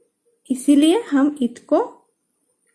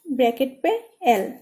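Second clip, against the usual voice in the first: ordinary talk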